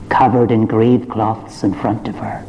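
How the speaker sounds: ordinary delivery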